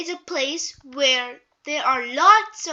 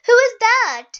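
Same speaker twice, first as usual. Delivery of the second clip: excited question; 8 semitones higher, 10 dB louder than usual. Acted. Peppa spots a fish.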